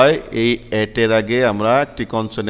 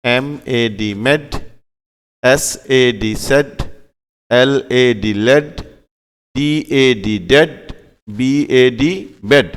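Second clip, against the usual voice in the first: slow letter spelling; much slower than usual. Shown as a demonstration. Phonics teacher spelling letters slowly.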